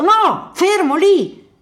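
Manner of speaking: very high, loud warning shout; urgent shout. Acted. Man on a mine warning the approaching boy, shouting. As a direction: stop him dead with your voice.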